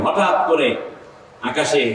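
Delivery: ordinary delivery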